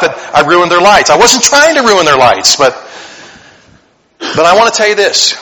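ordinary talk, nothing marked

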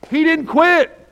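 high, loud burst; shouted climax. Real, not acted. Pastor to the congregation, shouting the climax. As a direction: cry it out to the church.

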